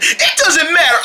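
ordinary talk, nothing marked

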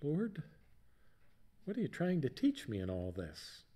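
softly asking the Lord; a hushed, sincere plea; quiet prayer. Real, not acted. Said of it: "I've done a lot of asking".

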